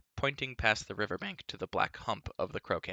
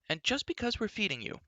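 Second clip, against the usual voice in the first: put-on much higher, tart girl's voice; indignant young princess; princess crying out; 12 semitones higher than usual. A narrator's reading. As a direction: snap it at him as a spirited girl. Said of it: "cried Elenwy".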